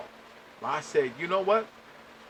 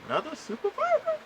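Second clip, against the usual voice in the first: incredulous. Real, not acted. Disbelief at another supervisor.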